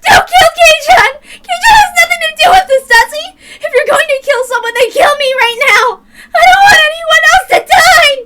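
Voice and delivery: put-on high, loud crying girl's scream; sobbing scream. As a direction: beg through sobs, voice breaking.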